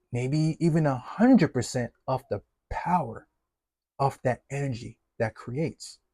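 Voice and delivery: normal talking voice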